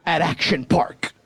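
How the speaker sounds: ordinary talk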